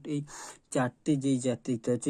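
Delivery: ordinary delivery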